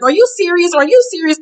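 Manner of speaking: high, incredulous double-take; mock disbelief. Imitating castmates, high incredulous disbelief.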